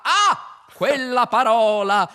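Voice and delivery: very high, loud shriek of horror; horrified ghost cry. Acted. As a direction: recoil screaming at the word. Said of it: "ghosts shocked by word".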